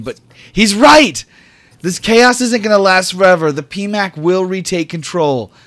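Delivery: high, loud, driving declaration; high loud righteous Dell; forceful speech. Acted. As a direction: declare justice is coming. GM voices Dell's speech.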